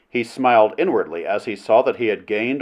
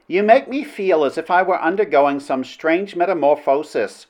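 higher-pitched princess voice; spellbound princess; 6 semitones higher than usual. Acted. Princess confesses to Flynn his mesmeric influence, spellbound. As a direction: speak as a woman falling under a spell.

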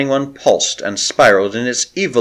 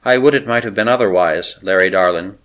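plain reading voice

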